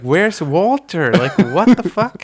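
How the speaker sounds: high, incredulous and laughing; laughing mock bafflement. Viewer wondering where Walter is, laughing bafflement. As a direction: exclaim it in amused disbelief. Viewer bewildered by missing groom.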